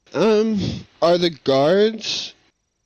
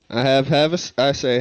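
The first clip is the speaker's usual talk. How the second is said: normal talking voice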